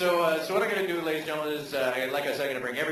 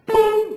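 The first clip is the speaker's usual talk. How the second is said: much higher, loud shouted boom; explosive boom; 11 dB louder than usual. Acted. Showing the US attitude, a loud shouted boom. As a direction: blast it out like a gunshot.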